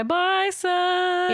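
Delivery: high, sing-song little goodbye; a playful high wave to the sun; cheery farewell. Pretend. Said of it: "we wave at it as it goes by".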